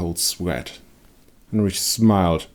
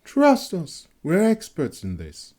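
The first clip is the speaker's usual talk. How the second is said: put-on young woman's voice; 11 semitones higher than usual. A narrator's reading. Young woman reassures villagers.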